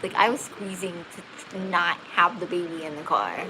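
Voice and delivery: ordinary talk, nothing marked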